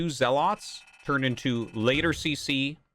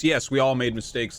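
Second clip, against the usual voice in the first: ordinary delivery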